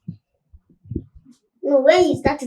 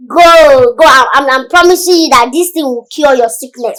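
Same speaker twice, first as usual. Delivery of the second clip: loud, insistent assurance; big loud salesman-like assurance; emphatic promise; 8 dB louder than usual. Acted. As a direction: promise it forcefully, voice raised. Healer reassures patient, dismisses payment.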